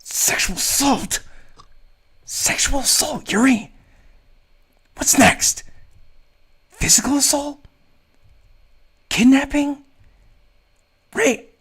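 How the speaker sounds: hiss-shout each word in outrage; stage whisper. Acted. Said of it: "I begin to whisper yell".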